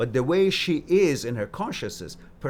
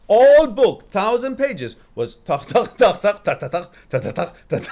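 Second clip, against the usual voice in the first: much louder and higher clip-clop sounds, building to a laugh; loud hoofbeat punchline; 8 semitones higher, 12 dB louder than usual. Retold or imitated. Telling viewers the joke's punchline, loud clip-clop laughing. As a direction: blurt the trotting noises like a punchline. Punchline of horse book joke.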